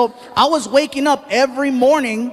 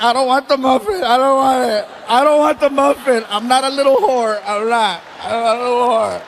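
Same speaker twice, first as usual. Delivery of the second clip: mock crying. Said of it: "he makes me cry at breakfast".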